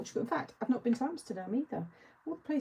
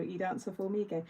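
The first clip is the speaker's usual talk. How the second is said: ordinary delivery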